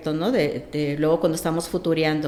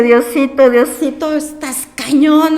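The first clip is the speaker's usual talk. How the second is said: high, loud tearful cry to God, words stretched slowly; retold crying plea; 6 semitones higher, 8 dB louder, slower than usual. Mother crying out to God when the school calls, high loud tearful plea. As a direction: sob it out to God, half reliving it. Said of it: "empiezo a llorar como enloquecida".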